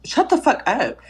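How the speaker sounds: high, thrilled shut the fuck up; excited shut up. Real, not acted. [name] thrilled friend knows the latte, high squeal. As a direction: squeal it in delight.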